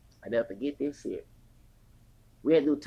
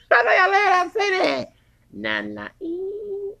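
very high, loud insisting shout; excited shout; 7 dB louder, slower than usual. Real, not acted. Insisting she's not lying.

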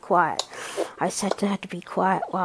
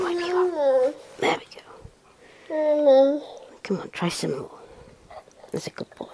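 whispering; 8 semitones higher than usual. Real, not acted. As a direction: hush and coax gently. Soothing baby while feeding.

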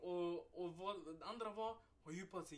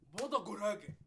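loud punchline; 4 semitones higher, 11 dB louder than usual. Real, not acted. Punchline of wrong shoes.

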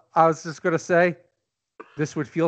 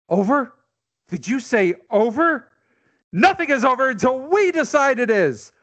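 fiery, rising rallying cry; roaring rallying speech; 7 semitones higher than usual. Acted. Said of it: "you're gonna read a famous speech".

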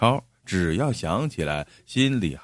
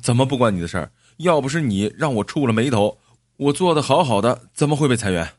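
acted angry retort, a touch raised; indignant, emphatic comeback; furious; 3 semitones higher, faster than usual. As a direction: throw the blame back hotly. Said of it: "张义超气冲冲地说".

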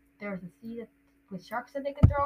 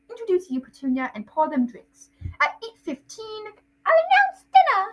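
pompous uncle, climbing higher as he plans; Uncle Vernon; 10 semitones higher, 4 dB louder than usual. Acted. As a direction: build to a grand announcement. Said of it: "that's Vernon".

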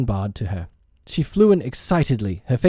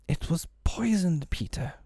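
high, soft fairy voice, fading; 6 semitones higher, 8 dB quieter than usual. A narrator's reading. Dying fairy telling Peter the truth, soft voice. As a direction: say it gently, dropping away on the name. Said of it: "she told him softly".

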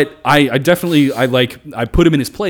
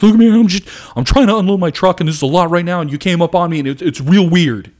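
higher, louder imitation of an upset man; retold tearful pleading; 6 semitones higher, 5 dB louder than usual. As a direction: plead in mock distress, pitched up. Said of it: "tears just start coming out of his face".

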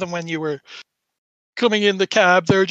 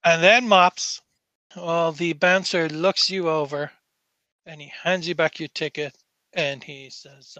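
ordinary talk

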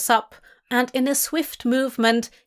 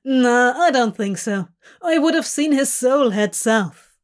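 casual shrug; 2 semitones higher, 4 dB louder than usual. Acted. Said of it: "says with a shrug".

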